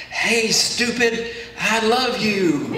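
high, drawn-out mock scream; hammed-up cartoon shout; exasperated scream. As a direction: yell it slowly, out of all patience. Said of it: "and she screams out".

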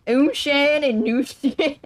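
put-on slow, high French words in a country accent. Learner showing off French in a country accent. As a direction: twang the French numbers like a cowboy. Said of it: "I love the country accent".

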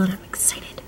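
unvoiced excited whisper. Real, not acted. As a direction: hush it like a secret. Whispered excitement before meeting friend.

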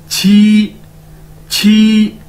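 छी repeated loud and aspirated; model pronunciation. Shown as a demonstration. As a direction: drill छी. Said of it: "क्यूआई को कैसे pronounce करेंगे?".

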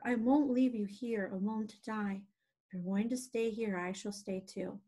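usual speaking voice

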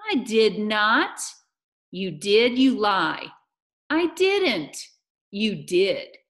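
loud, emphatic tit-for-tat; childish quarrel. Acted. Amanda and Mars Bar argue over who did it, childish quarrel. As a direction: trade denials and accusations loudly. Two children arguing back and forth.

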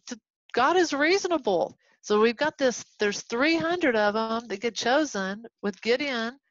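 usual speaking voice

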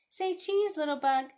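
high, loud playful call; bright sing-out in a high child voice; child playing photographer. A narrator's reading. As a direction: call out to pose the subject.

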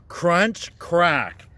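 ordinary delivery

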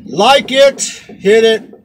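rally the viewers to subscribe; upbeat outro. Real, not acted. Host ends the video urging viewers to subscribe, upbeat.

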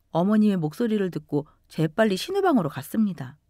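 ordinary delivery

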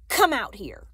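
loud command, falling sharply in pitch; barked order. A narrator's reading. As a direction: order them out forcefully. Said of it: "he ordered".

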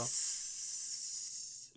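long hissed "s" with no vibration; voiceless S. Shown as a demonstration. Teacher demonstrates a sound that doesn't vibrate, sustained S. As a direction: hold "s" as pure air, cords silent. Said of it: "Là, ça ne vibre pas".

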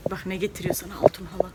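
whispered promise of milk. Real, not acted. Mother whispering to her daughter that the aunt brings milk. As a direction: hush it like a treat secret.

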